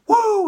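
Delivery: high, loud signature woo yell; Flair woo. Retold or imitated. Flair celebrating in the ring with a woo. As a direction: let out a big Flair woo. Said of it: "Flair sees it and Flair goes".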